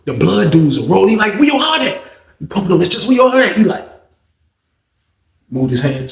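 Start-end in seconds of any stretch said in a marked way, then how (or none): 1.3-2.0 put-on high, laughing taunt of a drunk bully; mocking taunt. 3.1-3.6 put-on high, laughing taunt of a drunk bully; mocking taunt.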